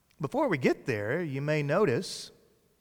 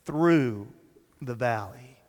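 "through" drawn out, then a pause before "the valley"; stress on THROUGH; 3 semitones lower, slower than usual. Shown as a demonstration. Preacher underlining one word of the psalm, stress on THROUGH. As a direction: set THROUGH apart as the key word. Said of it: "I want to emphasize that word there".

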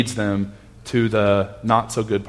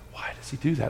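hushed question; 3 semitones higher, 13 dB quieter, faster than usual. Retold or imitated. Kids asking during bedtime story.

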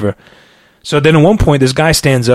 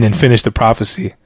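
usual speaking voice

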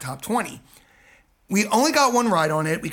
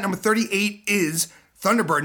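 ordinary talk

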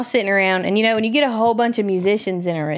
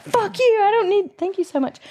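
half-hearted screaming back, pitched way up; retold screaming match; 12 semitones higher than usual. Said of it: "now we're both screaming at each other".

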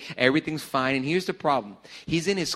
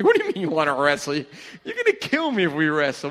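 loud, laughing mock panic; laughing disbelief; 3 semitones higher, 6 dB louder than usual. Teasing his huge brother, laughing in mock alarm. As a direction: protest through a laugh.

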